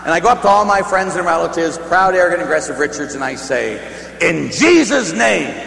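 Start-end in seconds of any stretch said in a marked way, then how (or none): 4.2-5.5 slow, raised, booming invocation; exorcist's command. Retold or imitated. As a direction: draw it out like a showy exorcist. Performing an exorcism in dream.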